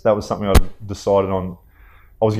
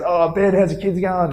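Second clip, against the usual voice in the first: higher, chatty cabbie voice, overly familiar; taxi driver; 10 semitones higher than usual. Retold or imitated. Taxi driver greeting his famous passenger, high and chummy. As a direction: play the matey taxi driver who knows you. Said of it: "the guy turns and goes".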